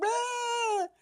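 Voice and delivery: very high, carefree yell out the car window; a freeing shriek while leaning out a car window; joyful scream out the car window. Retold or imitated. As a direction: let out a wild happy whoop into the wind. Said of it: "hang out at the car window going".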